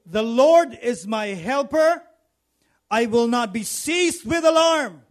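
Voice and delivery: slow, ringing confession of faith; bold declaration. Acted. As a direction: say it boldly, like a battle cry of faith. Declaring the verse boldly.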